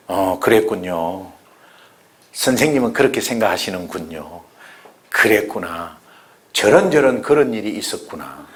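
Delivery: tender listening reply; gentle sympathy. Shown as a demonstration. Models empathetic reply to unbeliever.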